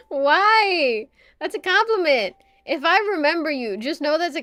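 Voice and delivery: high, loud wail of objection; playful loud protest. Real, not acted. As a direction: protest loudly with a high, swooping pitch. Mock protest at a chat message.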